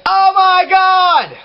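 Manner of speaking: high, slow, drawn-out mock yell; yelled talking. Shown as a demonstration. Imitating bands yelling into the mic, sustained high yell. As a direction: yell the words like a bad metal vocalist. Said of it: "yelling into the mic, just, like, talking".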